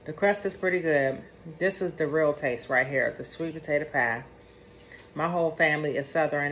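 eating pie with a fork; talking while chewing. Real, not acted. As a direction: mouth partly full of pie. Seen 0: bites pie at 0.4, chews, speaks with food in mouth.

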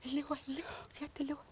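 unvoiced urgent whispering. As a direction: hush and call him secretly. Calling the general on phone.

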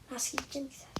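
exasperated whisper at the screen; frustrated whisper. Real, not acted. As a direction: hiss it to yourself in defeat. Stuck, muttering at the game.

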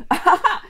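high, loud "ahaha"; acted laugh. A narrator's reading. Vicky's hair is stroked by a Looney, laughing. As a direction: burst into a short ticklish laugh. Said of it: "lachte Vicky".

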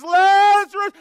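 high, ringing shout; loud cry. Acted. Jesus calling the dead man out of the tomb, loud cry. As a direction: call the name out at full voice. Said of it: "cries out in a loud voice".

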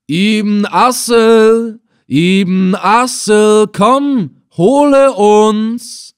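very slow, drawn-out syllables, high and loud; calling through cupped hands. Acted. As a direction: call across the water, stretching every syllable. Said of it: "indem ich die Silben langsam ausdehnte". (langsam gedehnt und laut durch die hohlen Hände rufen)

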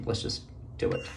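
low whisper, psyching herself up. Real, not acted. Nervous mom about to shave her head, whispering. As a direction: breathe it out under her breath.

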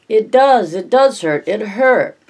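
high, loud tearful insisting; a little girl sobbing that it does hurt; wailing child. Acted.

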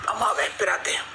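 susurrando bajito; susurro. Real, not acted. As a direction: en voz baja, sin voz. Preparando escena con la perra.

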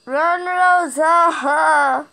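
real shout at the game character; urgent shout. Real, not acted. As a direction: yell at her to run, stretching each word. Urging character to flee.

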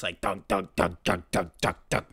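low, quiet mouthed drum beats; kick drum imitation. Shown as a demonstration. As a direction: thump out the kick drum softly. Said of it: "the kick drum comes in".

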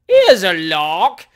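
loud, drawn-out jeer; gleeful shout. A narrator's reading. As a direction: shout it slowly across the street. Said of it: "shouted half a dozen hackney coachmen".

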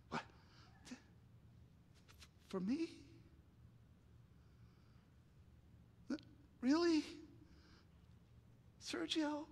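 high, soft, halting questions; acting overcome, voice thin and quiet with long pauses; tearful disbelief. Bob at his surprise party, tearful disbelief. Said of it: "Bob begins to tremble. Tears coming down his face".

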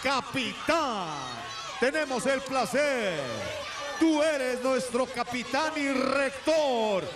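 slow, shouted ring introduction; lucha libre announcer. Acted. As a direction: hype the crowd like a ring announcer.

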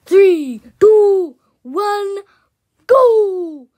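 hyped countdown yell; countdown shout. Real, not acted. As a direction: call the countdown out loud to launch it. Counting down a game start.

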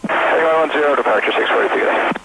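tinny cockpit radio transmission; pilot over the radio. Real, not acted. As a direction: read back like a pilot on the radio. Pilot reading back on radio.

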